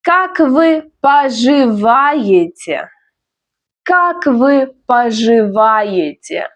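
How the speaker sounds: slow, drawn-out Как вы поживаете; repeat-after-me model. Shown as a demonstration. Teacher has learners repeat Как вы поживаете, slowly. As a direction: stretch each syllable of поживаете for learners. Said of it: "Repeat after me".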